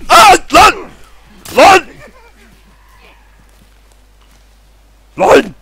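very high, very loud terrified yelps; panicked screaming. Real, not acted. Saldırı anında panik çığlık. (panik içinde çığlık)

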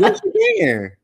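insistent high yes you can. Real, not acted. Host teasing guest to yell her phrase, high insistent.

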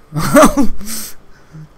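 loud burst of laughter. Real, not acted. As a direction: break into a big laugh. Laughs at own joke.

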